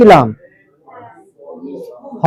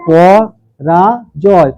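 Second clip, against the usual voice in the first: পরাজয় slowly as po, ra, joy; split into syllables; 3 semitones lower, much slower than usual. Shown as a demonstration. Teacher shows how to write the syllables, slow and separated. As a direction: pause after each part of পরাজয়. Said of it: "জয়টা একত্রে আনো".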